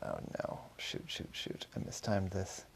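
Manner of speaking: hushed, panicked inner thought; panicked whisper. A narrator's reading. Hiding boy curses to himself under his breath. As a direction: whisper it so nobody hears. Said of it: "He thought".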